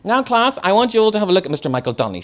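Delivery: high, loud, fast proud teacher; teacher proudly presenting her pupil; teacher addressing class. A narrator's reading. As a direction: announce him to the class.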